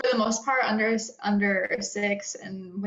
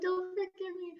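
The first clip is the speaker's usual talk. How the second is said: higher and softer, playful pleading; mock plea; 10 semitones higher, 8 dB quieter, faster than usual. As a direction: beg the baby gently. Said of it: "I'm like".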